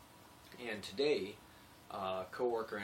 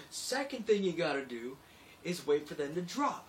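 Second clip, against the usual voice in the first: higher, louder tough-guy voice; tough iron worker; 7 semitones higher, 7 dB louder than usual. Retold or imitated. Iron worker giving the narrator crude advice, macho voice. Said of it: "he goes".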